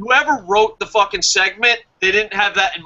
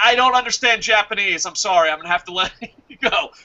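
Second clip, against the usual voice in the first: usual speaking voice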